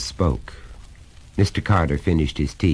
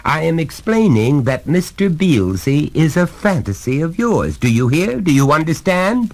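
higher, louder, hammering each point; stern father, pitched up and insistent; exasperated lecturing father; 12 semitones higher, 5 dB louder than usual. Acted. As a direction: lay down the law to a small son.

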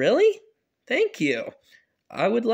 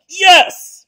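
angry shout; 10 semitones higher, 13 dB louder than usual. Acted. Dad yells back.

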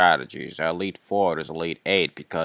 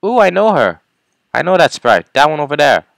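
loud, high excited outburst; 8 semitones higher, 12 dB louder than usual. Real, not acted. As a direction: burst out recognizing her. Spotting a familiar gym leader.

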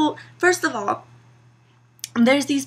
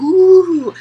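a sudden, much louder, higher "Ooh" of delight; mock excited coo; 6 semitones higher, 12 dB louder than usual. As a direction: pretend to be thrilled by a phone buzz. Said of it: "I whip it out and I'm like".

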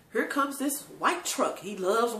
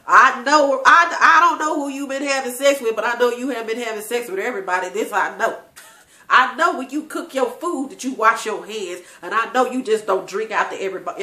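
loud, emphatic comic protest; loud insistence; 9 dB louder than usual. Real, not acted. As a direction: insist at full voice, half laughing by the end. Loud joking defense of family kissing.

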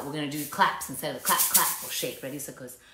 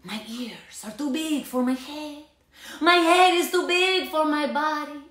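put-on Spanish accent; 9 semitones higher than usual. A narrator's reading. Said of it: "using his very best Spanish accent".

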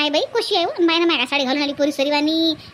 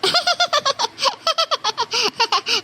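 long, high cackling laugh; mocking cackle; 6 semitones higher than usual. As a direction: cackle teasingly at someone. Woman mocks the groom's aunt.

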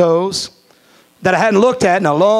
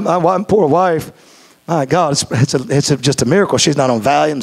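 ordinary talk

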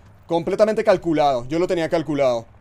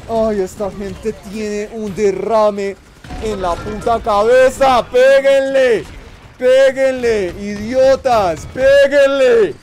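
higher, louder, slow and drawn out; exasperated yelling; 5 semitones higher, 7 dB louder, much slower than usual. Real, not acted. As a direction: plead in frustration at useless teammates. Yelling at bad teammates.

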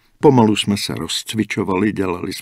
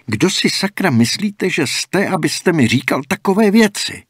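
much higher, louder, offended demand; indignant outburst; 10 semitones higher, 5 dB louder than usual. Acted. Dan snaps at the old man's insult, indignant outburst. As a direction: fire it back, stung and furious. Dan loses his temper.

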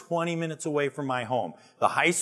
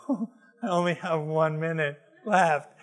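said through a laugh, slower; 5 semitones higher than usual. Retold or imitated. Man waiting on a late client, laughing. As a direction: chuckle at your own impatience.

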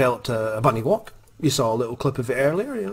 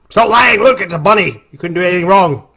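scolding mom; 6 semitones higher, 9 dB louder than usual. Retold or imitated.

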